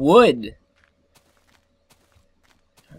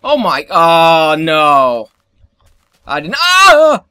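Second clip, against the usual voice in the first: loud startled cry; panicked gamer yelp; 10 dB louder, slower than usual. Real, not acted. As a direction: yelp as it lunges at you. Startled by mob chasing him.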